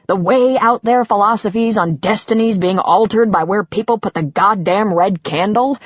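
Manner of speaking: ordinary delivery, nothing marked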